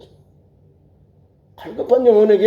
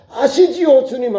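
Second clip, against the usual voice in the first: high, quicker "아시지요"; crying plea; 3 semitones higher than usual. Acted. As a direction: call "아시지요" up to God urgently. Same plea cried out before God. ("아시지요"를 높게 부르짖듯)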